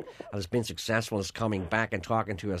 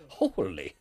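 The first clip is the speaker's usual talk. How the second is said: imitation of a friend's catchphrase; put-on exclamation at something unusual; slightly lowered mimicked voice, mildly amazed; Tony Macaulay; 3 semitones lower than usual. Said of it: "Tony used to always do a particular thing".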